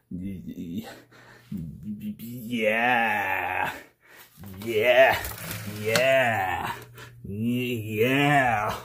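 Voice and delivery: low, breathy-sounding mock rapture; mock sultry moaning. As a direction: purr yeah in hammed-up pleasure. Savoring his nerd corner in mock bliss.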